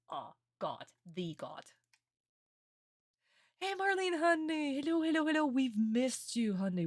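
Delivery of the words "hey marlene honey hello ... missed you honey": high, loud, delighted welcome; excited greeting. Real, not acted. Greeting Marlene in chat, high and loud. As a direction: call out to her with joy.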